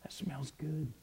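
breathy hushed whisper of delight; awed whisper. Acted. Mary sniffing the ointment in her room, whispering in awe. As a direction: whisper it as if savoring the scent. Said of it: "and she says".

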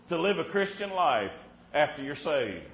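ordinary talk

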